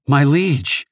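trembling, awestruck "My liege"; shaky voice. Acted. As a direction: voice quivering with nervous reverence. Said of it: "she said in a shaky voice".

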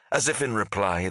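plain reading voice

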